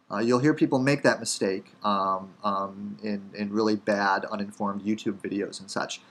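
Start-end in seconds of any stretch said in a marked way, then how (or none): none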